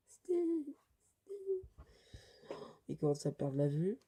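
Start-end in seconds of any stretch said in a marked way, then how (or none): 0.1-1.6 very high, thin voice calling a friend; squeaky calling. Acted. Lector calling to starving Sting, squeaky calling. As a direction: call the name in falsetto.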